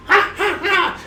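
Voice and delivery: high cackling witch laugh; witch cackle. A narrator's reading.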